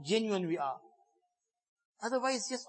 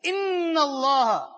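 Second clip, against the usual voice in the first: "Inna Allah" drawn out slowly and loud, a catch of crying in it; slow emphatic inna; 6 semitones higher, 7 dB louder, much slower than usual. Acted. Preacher stressing "Inna" to the congregation, slow and tearful. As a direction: stretch "Inna" out and lean hard on it.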